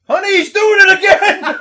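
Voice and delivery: high, very loud holler; mock parental yell. Parent calls out about crying son, mock exasperated yell. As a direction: yell it out as a running gag. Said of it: "He just keeps yelling that out".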